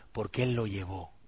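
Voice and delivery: ordinary delivery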